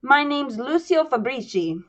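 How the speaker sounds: put-on a light Little Italy accent; a hint of a Little Italy mobster accent; Italian-American accent. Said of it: "I'm still doing his accent".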